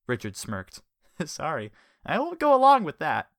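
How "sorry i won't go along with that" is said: high, loud smug refusal; cheeky refusal. A narrator's reading. Richard smirking at Jellyfish's threat, high smug refusal. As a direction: brush him off with a smirk.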